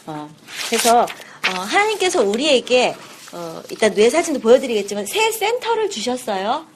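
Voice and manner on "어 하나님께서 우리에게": ordinary delivery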